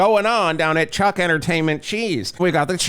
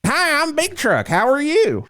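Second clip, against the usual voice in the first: even higher country mascot greeting; country version; 12 semitones higher than usual. Acted. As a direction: pitch it way up like a country pitchman. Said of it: "I'm the country version of Chuck Entertainment".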